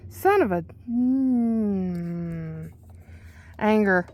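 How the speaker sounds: ordinary talk, nothing marked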